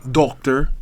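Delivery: imitating an Erie accent on "doctor". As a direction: mimic his Erie vowel in "doctor". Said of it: "has an Erie accent".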